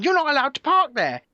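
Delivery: imitating her nagging; retold shouting. Mimicking the woman still yelling at him. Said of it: "And she just kept going".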